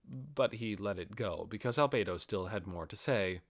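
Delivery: plain reading voice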